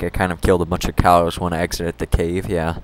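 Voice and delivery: ordinary talk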